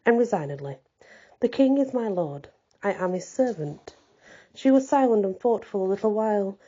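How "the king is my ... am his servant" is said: plain reading voice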